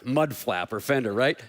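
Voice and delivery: usual speaking voice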